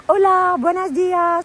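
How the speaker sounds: high, bright-eyed hola to passing cyclists; cheery Spanish greeting. Retold or imitated. Cyclists in Mallorca greeting her as she passes, high and cheery. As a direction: sing out the hello like a friendly local rider. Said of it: "everybody I passed was like".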